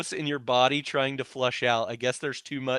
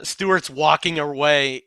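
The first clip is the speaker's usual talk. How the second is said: ordinary talk, nothing marked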